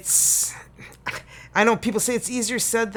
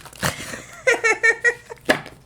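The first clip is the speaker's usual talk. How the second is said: high burst of laughter; 6 dB louder than usual. Real, not acted. Laughing at her own joke.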